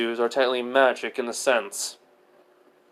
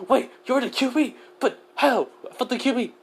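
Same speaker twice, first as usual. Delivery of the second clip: pitched way up, astonished and breathless; shocked disbelief; 11 semitones higher than usual. Acted. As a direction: Naruto can't believe who's standing there. Naruto shocked to meet Q B.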